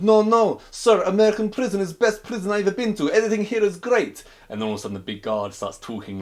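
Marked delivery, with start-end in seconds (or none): 0.0-4.2 high, loud mock Russian voice, broken English; hammed-up Russian accent. Russian inmate answering the big guard, hammed-up Russian accent.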